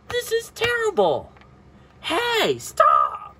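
high, squealed pretend complaint; mock upset protest. Child telling playmates to stop, high mock protest. Acted quarrel in play.

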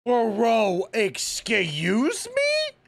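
loud, high, drawn-out disbelief; incredulous. Real, not acted. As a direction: stretch it into a rising question. Incredulous reaction to the trailer.

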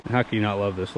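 ordinary delivery

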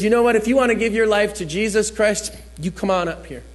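loud, high call to the room; imitated altar call. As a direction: call out an invitation as a preacher. Voices MC making altar call.

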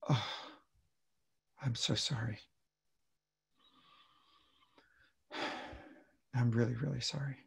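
very soft, sighing condolence; grieving sympathy. Acted. Consoling a grieving adult, hushed sympathy. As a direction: say it hushed and heartfelt, with long pauses. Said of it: "the way you say the words".

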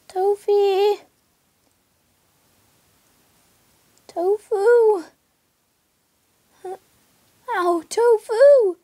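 calling a lost pet from afar. Real, not acted. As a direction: call the name out loud across a field. Calling lost cat loudly.

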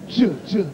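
"J" said as a short "juh"; isolated J sound. Shown as a demonstration. Preacher demonstrating the letter, "J" voiced as "juh". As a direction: sound out "J" as in judge, twice. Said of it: "has a J sound".

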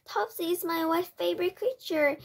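alarmed protest. A narrator's reading. Alarmed father protests about the cat.

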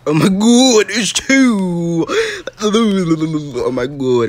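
loud, high-pitched alarm; startled gamer panic, loud and high; panicked yelp. Real, not acted. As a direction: cry out as danger suddenly appears. Startled by enemies in game.

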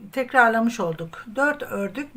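ordinary talk, nothing marked

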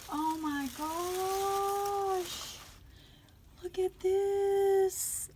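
high, slow, drawn-out gushing; moved to tears. Real, not acted. Crafter touched by friend's handmade gift, moved and gushing. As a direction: stretch each word, overwhelmed by a gift. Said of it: "you're gonna make me cry again".

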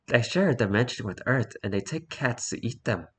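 hushed, eerie sleep-talk; very soft acted sleeper's voice. Boyfriend's creepy sleep-talk read out.

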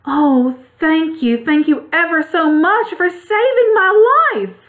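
high, drawn-out squeaky mouse voice; squeaky little mouse. A narrator's reading. Mouse thanks the Woodman for saving her life, squeaky. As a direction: squeak it out high, slow and grateful. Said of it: "said in a squeaky little voice".

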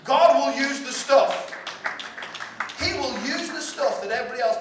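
preacher's full-voiced cry; emphatic shout. Real, not acted. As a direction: drive it home with force.

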